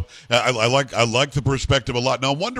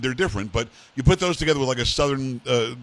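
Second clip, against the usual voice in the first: ordinary delivery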